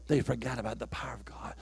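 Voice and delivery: breathy whispered assurance, no voice. Acted. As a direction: lean in and whisper it to the church. Preacher whispers point.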